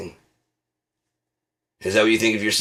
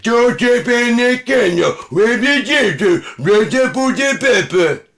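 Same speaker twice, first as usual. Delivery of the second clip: mock tongues gibberish; 10 dB louder than usual. Comic parodies tongues as kitchen nonsense, high and loud. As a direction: wail gibberish like a possessed preacher. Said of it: "It's not".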